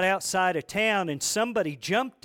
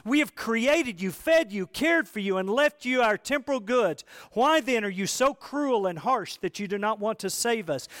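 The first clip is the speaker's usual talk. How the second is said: higher, louder reproachful plea from a parent; pleading parent; 5 semitones higher, 5 dB louder than usual. Retold or imitated. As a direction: scold your child for not saving you. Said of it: "as the father says to the son".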